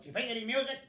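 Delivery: imitating a loud, high, brusque pianist; pianist's question. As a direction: bark it from the piano bench. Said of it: "she goes".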